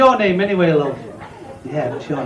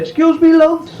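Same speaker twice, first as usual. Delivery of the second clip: customer voice; 7 semitones higher, 3 dB louder than usual. Acted.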